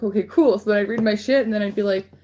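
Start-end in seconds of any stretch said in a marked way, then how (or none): 0.0-0.6 smug okay. Retold or imitated. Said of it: "And I was like".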